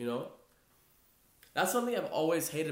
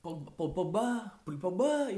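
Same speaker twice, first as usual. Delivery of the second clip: French accent; 4 semitones higher than usual. Shown as a demonstration. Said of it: "with the French accent".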